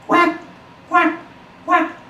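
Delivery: high, squeaky duckling quacks; little ducklings quack politely in turn. A narrator's reading. Ducklings greet the special duck.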